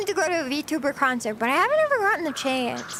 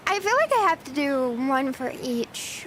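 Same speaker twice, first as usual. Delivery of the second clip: ordinary talk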